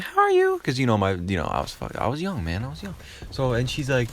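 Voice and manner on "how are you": put-on very high little-kid squeak; mock kid greeting. Young nephew greeting his aunt, squeaky mock politeness. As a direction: pitch it way up like a small child.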